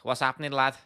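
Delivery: put-on playful Scouse accent; jokey Liverpool accent impression; Paddy the Baddy. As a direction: put on a Scouse greeting, laughing midway. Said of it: "Paddy Axon, what a scouse".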